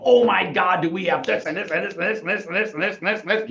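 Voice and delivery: high, rapid-fire gushing; hammed-up excitement, racing through a list; over-excited. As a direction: gush high and fast without a breath. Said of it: "get all excited and go".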